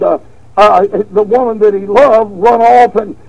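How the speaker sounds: normal talking voice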